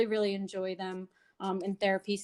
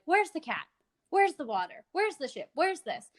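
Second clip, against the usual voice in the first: quiz drill; 6 semitones higher than usual. Shown as a demonstration. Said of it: "doing a drill".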